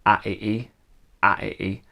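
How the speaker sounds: "a, e, e" as the bare vowels of gravity, no consonants; vowels only. Shown as a demonstration. Songwriting teacher stripping gravity for learners, "a, e, e" as pure vowel sounds. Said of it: "we're gonna take away the consonants".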